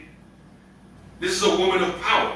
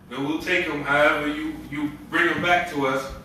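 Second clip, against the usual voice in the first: ordinary delivery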